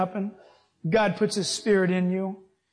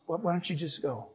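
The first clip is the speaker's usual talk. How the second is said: hushed aside; 4 semitones lower, 8 dB quieter than usual. Retold or imitated. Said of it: "I said".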